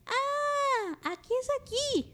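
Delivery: voz de niñita de títere mucho más aguda; voz de niña. Retold or imitated. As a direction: pregunta con vocecita de niña inocente, muy lento al final. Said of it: "animen esta voz". (vocecita aguda de niña de Plaza Sésamo)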